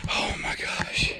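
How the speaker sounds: breathless, soft whisper of awe; awed whisper. Real, not acted. Kneeling by the giant buck, an awed whisper. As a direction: whisper it, overwhelmed.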